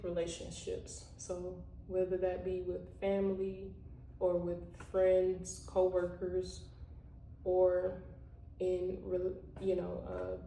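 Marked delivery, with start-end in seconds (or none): none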